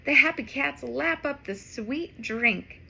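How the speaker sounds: plain reading voice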